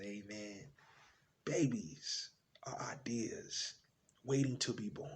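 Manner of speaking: low, soft, slow fatherly explanation; gentle dad. Acted. Dad answering a small son's question, gently. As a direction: explain it quietly, with space between phrases. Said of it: "He smiled. He said".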